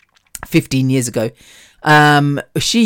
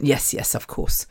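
much softer imitated reply; quiet mock assent; 4 semitones lower, 10 dB quieter than usual. Manager assures customer the food is fresh, hushed mock assent. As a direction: drop the voice right down, mimicking him. Manager brushing off her request.

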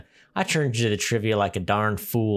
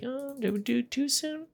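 much higher, softer put-on voice; mock surprise; 6 dB quieter than usual. Reviewer voicing his surprise at the shark, mock high voice. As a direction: pipe it up in fake surprise. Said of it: "I was like".

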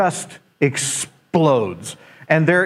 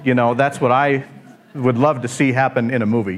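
usual speaking voice

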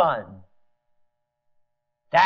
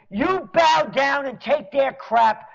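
ordinary talk

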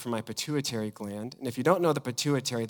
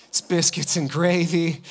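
ordinary talk

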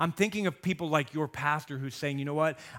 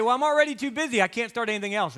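higher, louder, quick whiny excuse; mock busy excuse; 8 semitones higher, 7 dB louder, faster than usual. Listener answering Jesus, mock whiny excuse. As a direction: toss off the excuse in a rushed mock whine. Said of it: "You say".

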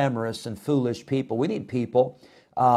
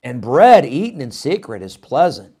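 tempting voice; 3 semitones higher, 8 dB louder than usual. Retold or imitated. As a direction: savour the forbidden. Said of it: "she says".

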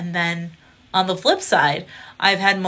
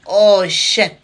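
louder, caught-out exclamation; retold shocked curse; 2 semitones higher, 10 dB louder than usual. Comic caught out by the club president, loud curse. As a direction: blurt it like you just got busted. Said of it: "And I was like".

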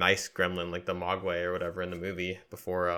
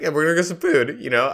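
loud coaxing; 9 semitones higher, 9 dB louder than usual. Retold or imitated. Friends coax him to eat.

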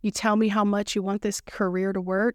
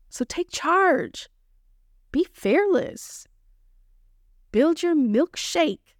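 rallying call; 6 semitones higher, slower than usual. Acted. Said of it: "slow rousing commands".

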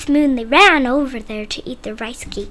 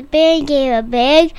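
usual speaking voice

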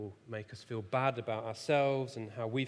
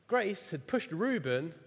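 ordinary talk

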